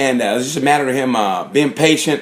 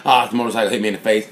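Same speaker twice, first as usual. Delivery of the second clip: mock rider excuse; 5 semitones lower, 3 dB quieter, much faster than usual.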